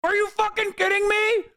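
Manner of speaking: high, shouted disbelief; outraged shout. Real, not acted. Streamer yelling at the video in disbelief. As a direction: yell it at the screen.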